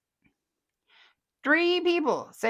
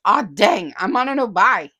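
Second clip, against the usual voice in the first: louder mock groan of regret; mock dismay; 9 dB louder than usual. Host reading a viewer's no-buy lament, loudly. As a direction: moan about the no-buy.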